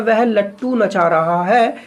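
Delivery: slow dictation-style reading of the sentence; teacher reading a sample sentence slowly. Shown as a demonstration. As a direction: read it out slowly, word by word. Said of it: "अब sentence होता है".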